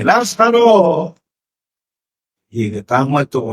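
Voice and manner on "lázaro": high, drawn-out call; calling Lázaro. Acted. Jesus calling Lázaro out of the grave, long and high. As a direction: stretch the name out like a call into the tomb. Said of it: "Y grita".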